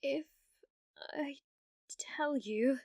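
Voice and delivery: hesitant whisper. Acted. Hesitant confession.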